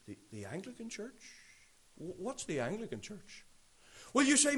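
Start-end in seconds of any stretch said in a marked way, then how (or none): 0.0-3.5 soft, baffled echo of the question; a quiet, bewildered old brother who never heard of it; mock puzzled elder, hushed. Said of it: "he would look at you and he would say".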